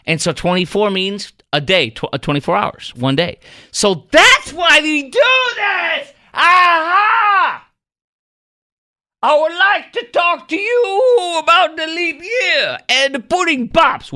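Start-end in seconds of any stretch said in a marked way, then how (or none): 3.7-14.1 high, loud and slow, a shouted put-on voice with a crying edge; hammed-up comic outburst. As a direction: bellow it like a melodramatic pitchman finding the answer. Sudden comic outburst, character voice.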